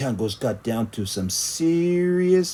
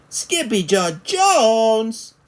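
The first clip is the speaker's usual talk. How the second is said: slow, higher, louder shout; exclaiming cry; 11 semitones higher, 6 dB louder, much slower than usual. Acted. Family crying out his name in surprise. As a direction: call out his full name. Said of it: "everyone cried".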